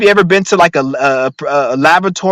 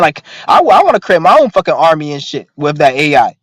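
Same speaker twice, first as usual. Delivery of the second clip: ordinary talk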